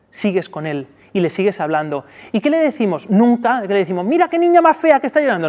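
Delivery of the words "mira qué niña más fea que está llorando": high, fast teasing scold; mock the crying child, quick and high; mocking parent. Shown as a demonstration. Wrong thing a parent tells a crying girl, fast high teasing. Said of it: "No, eso no".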